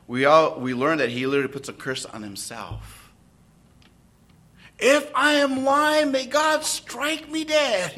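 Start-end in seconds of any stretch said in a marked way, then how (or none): 4.8-7.9 Peter's oath. Acted.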